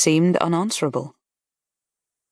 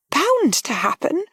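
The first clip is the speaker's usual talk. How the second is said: higher, slow, disbelieving question; young lady echoing in disbelief, higher; startled echo; 11 semitones higher, slower than usual. A narrator's reading. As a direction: repeat it as if stunned. Harriet echoes in disbelief.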